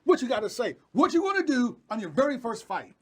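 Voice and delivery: high-pitched, driving hype build-up; hyped live interviewer. Pretend. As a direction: pump up the fighter like a live interviewer. Mock live interview hype, demanding smack talk.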